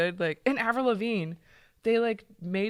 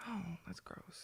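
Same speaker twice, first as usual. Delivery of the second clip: whisper; 20 dB quieter than usual. Real, not acted. Reacting to burped letter.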